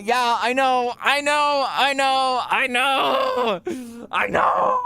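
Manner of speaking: squeaky protest rising to a high shout; building squeak. Acted. Streamer's squeaky protest to friend builds from high to a shrieked shout.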